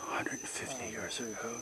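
hushed whisper at a grave. Real, not acted. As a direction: whisper it respectfully.

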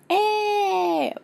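put-on long high-pitched childish squeal; high cheer. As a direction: cheer like a little one. Pretend baby cheers the surprise. (gritinho agudo de bebê)

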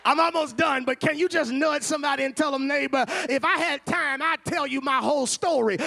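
loud, high, building sermon shout; preaching at full force. Acted. Preacher urging the church to nudge a neighbor, shouting.

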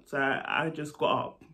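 ordinary talk, nothing marked

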